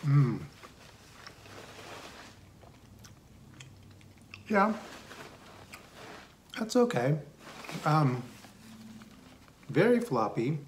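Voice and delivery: speaking between bites; talking while chewing. Real, not acted. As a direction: mouth full of food. Seen 1: Cheeks bulge, jaw chewing pizza while he speaks; R frames show none.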